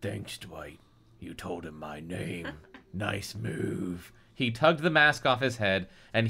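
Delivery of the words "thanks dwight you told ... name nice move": low, soft, sour villain; mock your partner's blunder quietly; acted hushed sarcasm; sarcastic thanks.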